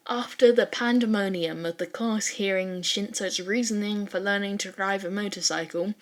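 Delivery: usual speaking voice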